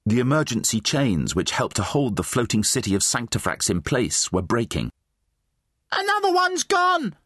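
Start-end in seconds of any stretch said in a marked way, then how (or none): 5.9-7.1 very high, strained scream; screamed alarm. Acted. Bystander as the chain breaks, high alarmed scream. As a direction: scream the alarm across a street. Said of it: "someone screamed".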